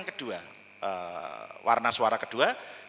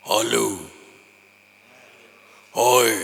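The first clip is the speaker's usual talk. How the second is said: big voice; 6 semitones lower, 5 dB louder, slower than usual. Shown as a demonstration. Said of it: "suara yang dibuat besar".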